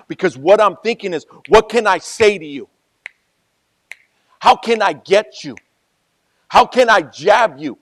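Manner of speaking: high, loud and heated; fired-up preaching. Real, not acted. Preacher showing the congregation his heated scheming.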